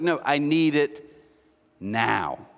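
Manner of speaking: pause, then drop heavily onto the last word; imitated insistent demand. Imitating neighbour demanding the Xbox, slow and insistent.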